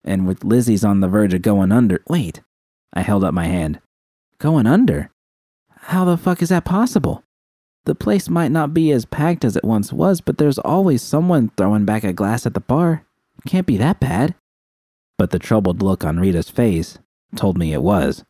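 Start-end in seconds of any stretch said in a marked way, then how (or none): 4.4-14.3 put-on higher, disbelieving young woman's voice; incredulous Judy. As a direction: push back in shock, a touch higher. Judy's incredulous reply.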